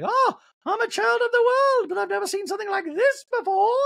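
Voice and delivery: hammed-up wide-eyed marvelling way up high; mock fascination. Onlooker fascinated by the show, squeaky mock wonder. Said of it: "look of fascination".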